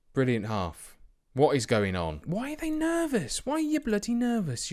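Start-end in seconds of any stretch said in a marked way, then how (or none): 2.3-4.7 much higher, strained falsetto of disbelief; high mock-despairing whine; exasperated squeak. Real, not acted. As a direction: squeak it out at the nervous players. Manager frustrated at timid team.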